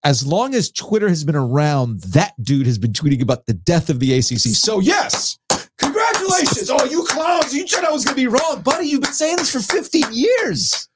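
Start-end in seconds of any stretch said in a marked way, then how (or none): 4.5-10.9 high-pitched sarcastic outburst; mock congratulations. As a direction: congratulate him at the top of your pitch, mockingly. Mock celebration of an old prediction.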